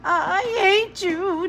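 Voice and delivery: put-on high, slow, tearful boy's cry; wailing child's sob; sobbing child. A narrator's reading. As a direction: sob it out in a tantrum. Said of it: "Jamie sobbed".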